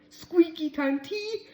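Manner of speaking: high, slow words through laughter; laughing mockery. A narrator's reading. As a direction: jeer it while laughing. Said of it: "they started laughing".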